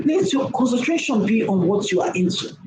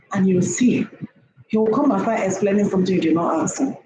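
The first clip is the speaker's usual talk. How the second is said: ordinary talk, nothing marked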